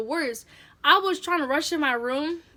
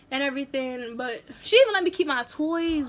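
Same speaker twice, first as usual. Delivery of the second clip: normal talking voice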